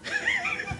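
a quick, high-pitched burst of laughter; high giggle. Real, not acted. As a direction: giggle up high at your own nerve. Laughs at his own question.

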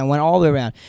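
usual speaking voice

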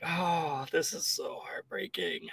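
slow, breathy whisper of mock grief; mock heartbroken whisper. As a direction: whisper it like it breaks your heart, slowly. Mock sorrow at sad ending.

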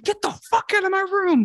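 kicking the girl out of his room, high mock yell. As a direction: retell yelling her out of the room. Yelling at the girl.

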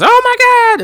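high-pitched excited shout; King. Acted. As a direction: burst out like an overexcited ringside commentator. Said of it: "like, all excited and he goes".

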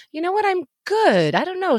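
high-pitched mock contentment; Hannah. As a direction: imitate a guest cheerfully at ease in chaos. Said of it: "now there's a pandemic and Hannah's like".

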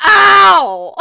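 loud cry of pain; pained yell. Retold or imitated. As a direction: yell ow like it hurts. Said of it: "and was like".

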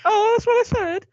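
high, loud feigned innocence; mock-innocent wife. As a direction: pipe up high, pretending not to understand. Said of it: "She'd be like".

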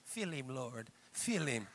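soft imitation of a praying old lady; little old lady. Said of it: "The other little old lady going".

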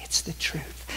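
whispered gently; soft hushed truth. Retold or imitated. Husband tenderly telling his wife, in a whisper. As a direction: breathe it out like a secret.

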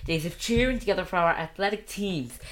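put-on American valedictorian accent. Shown as a demonstration. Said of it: "That was American".

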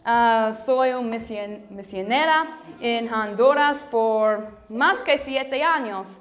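Spanish with a strong American accent. Real, not acted. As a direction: speak Spanish as an American learner. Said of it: "disculpa mi español porque es no perfecto".

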